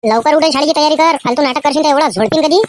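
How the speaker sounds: mother yelling at her son to get ready for school; scolding mother. Acted. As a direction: nag the lazy son like a furious village mom.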